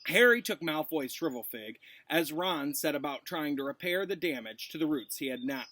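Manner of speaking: ordinary delivery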